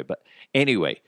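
ordinary talk

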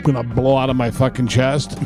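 normal talking voice

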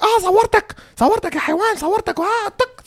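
very high-pitched squealed caricature; mock angry woman. Woman caught taking a picture, high mock tirade. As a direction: squeal it like a furious cartoon woman. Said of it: "He's like".

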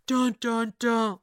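sung suspense sting; dramatic sting. Shown as a demonstration. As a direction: hum the soap-opera sting. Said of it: "dramatic sting".

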